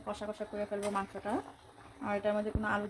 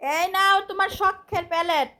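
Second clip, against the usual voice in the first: excited presenting; 9 semitones higher, 11 dB louder, slower than usual. Real, not acted. Serving husband his plate.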